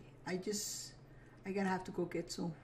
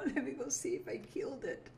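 usual speaking voice

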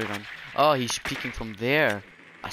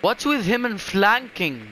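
high, slow exasperated complaint; high, slow, exasperated gripe at a flanking enemy; 9 semitones higher, 4 dB louder than usual. Real, not acted. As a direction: drag it out in disbelief.

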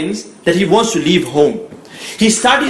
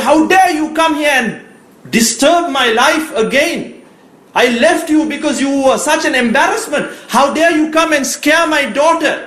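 raised, higher-pitched furious scolding; angry shout; 6 semitones higher than usual. Retold or imitated. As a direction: scold her hard, voice pitched up high. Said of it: "He shouts at his mother".

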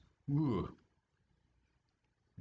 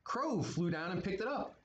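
ordinary delivery

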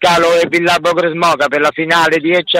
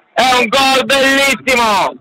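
shouted goal call; 7 semitones higher than usual. Real, not acted.

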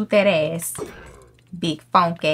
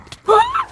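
high startled gasp; sharp scared gasp; 5 dB louder than usual. Real, not acted. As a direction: suck in air in fright. Sudden fright during raid.

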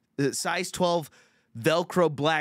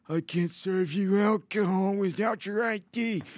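waiter impression; 7 semitones higher than usual. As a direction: mimic a whiny, thick-tongued waiter up high. Mimicking the Denny's waiter.

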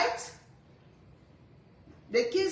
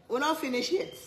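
ordinary talk

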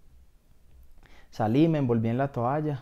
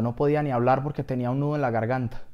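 usual speaking voice

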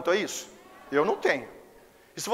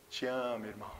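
soft, low loving words; gentle whispered-soft affection; tender te amo; 9 semitones lower, 13 dB quieter than usual. Shown as a demonstration. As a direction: say it softly like a wink of love. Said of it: "eu tô dizendo assim".

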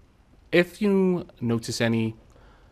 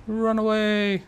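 panicked shout; 11 semitones higher, much slower than usual. Real, not acted. Fleeing the boss.

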